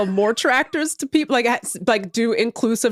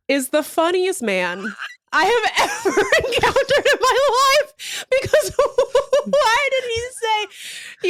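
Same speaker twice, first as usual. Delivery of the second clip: high, slow, laughing through it; wheezing with laughter; real laughter breaking the words; 12 semitones higher, slower than usual. Real, not acted. Cracking up retelling the video.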